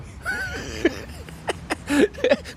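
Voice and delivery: high-pitched amused giggle; high laugh. Real, not acted. Laughing at own age.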